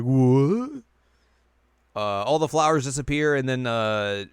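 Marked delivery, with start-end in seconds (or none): 0.0-0.8 loud, rising mock shock; hammed-up baffled outburst; startled what. Villain reacting to Kagome's refusal, mock startled. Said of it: "He's like".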